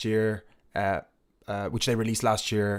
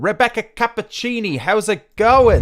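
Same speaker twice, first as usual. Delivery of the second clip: high, loud welcoming call; excited greeting; 9 semitones higher, 8 dB louder than usual. Real, not acted. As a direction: welcome her in brightly. Greeting the guest on air.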